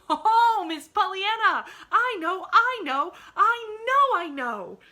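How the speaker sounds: very loud, high ecstatic cries; rapturous exulting. Acted. Maid exults to the girl, loud and rapturous. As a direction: burst out in rapture. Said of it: "she exulted rapturously".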